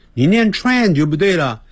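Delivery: usual speaking voice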